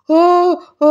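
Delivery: high, loud shriek before fainting; a hammed-up high scream; big cry. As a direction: let out a dramatic shriek. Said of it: "అని ఓ పెద్ద కేక వేసి".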